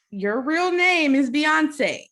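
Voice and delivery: high, loud, very drawn-out astonishment; amazed. Real, not acted. Host marvelling at her guest's real name, amazed. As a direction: stretch it out in awe.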